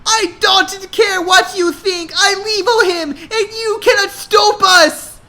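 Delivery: high, loud, crying screech; screeching yell. A narrator's reading. Hannah screaming at her dad to defend her love. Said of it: "yelled like a Hajarpi screecher".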